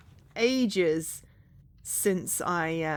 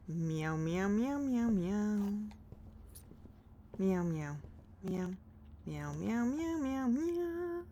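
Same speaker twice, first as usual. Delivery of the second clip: soft sing-song meows sliding upward; gentle pretend-cat crooning; playful meowing; 7 dB quieter, slower than usual. Idle cat meowing in meow mode.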